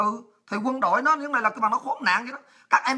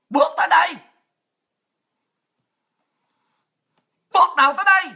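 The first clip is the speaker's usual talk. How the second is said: high, loud confrontational command; shouted challenge; 6 semitones higher, 5 dB louder, faster than usual. Real, not acted. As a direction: dare them to step up. Daring enemies to step up. (quát lên thách thức)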